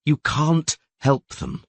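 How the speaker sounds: slow, dull and heavy. Acted. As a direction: say it flatly, drained. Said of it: "replied Dandelion in a dull voice".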